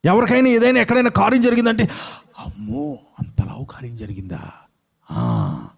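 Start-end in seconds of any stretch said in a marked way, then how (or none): 2.4-5.7 soft, low-voiced amazement, acted out; hushed mock awe. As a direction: drop to a much quieter voice, as if awestruck. Acts out excited reaction to news.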